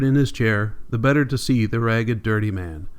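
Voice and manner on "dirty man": ordinary delivery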